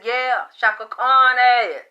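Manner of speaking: the singer's spirit, voiced through the medium, slow and loud. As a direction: lean back and drag it out, sassy. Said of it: "She said".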